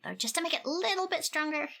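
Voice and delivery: normal talking voice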